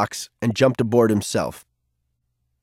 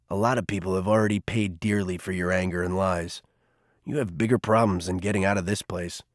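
put-on much lower and softer, wry older man; Garvey's dry low voice; 4 semitones lower, 6 dB quieter than usual. A narrator's reading. Garvey teasing Cole about his attitude, low and wry. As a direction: tease him gently, dry and unhurried.

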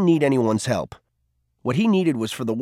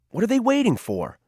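put-on much higher, whiny teen voice; mild complaint; 10 semitones higher than usual. A narrator's reading. Cole complaining to Garvey about the Circle, higher and whiny. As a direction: whine it like a fed-up kid.

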